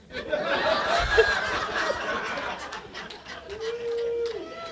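long high-pitched laugh. Real, not acted. Laughing at the driver's joke for the audience, long and high.

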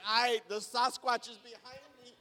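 loud, stretched-out scared yelp; mock panic. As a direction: yell it like your imagination has run wild. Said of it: "your mind goes crazy".

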